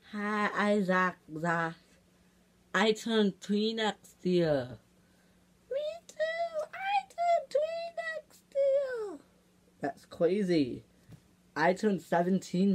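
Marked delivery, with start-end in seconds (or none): 0.1-4.8 put-on slow toddler voice at usual pitch; toddler talk. Baby introducing himself to viewers, slow toddler talk. 5.7-9.2 very high, slow, soft falsetto; tiny squeaky child. Pretend. Second baby echoing the first, tiny high voice.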